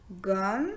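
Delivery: "gomme" as two beats, gom, then a separate me; split into syllables. Shown as a demonstration. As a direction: pause inside "gomme" before a voiced final me. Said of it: "composé de deux syllabes". (« gomme » en deux syllabes : gom, me)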